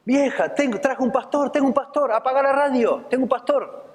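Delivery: high, excited calling out. Retold or imitated. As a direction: shout the news to his wife, turn off the radio. Old man calling to his wife.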